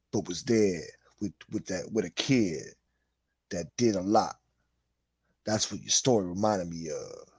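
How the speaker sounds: breathy whispered put-on voice, dropping low; Nightmare voice. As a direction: whisper it like a shadowy street kid. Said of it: "let me do the Nightmare voice".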